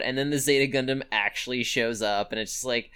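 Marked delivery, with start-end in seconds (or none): none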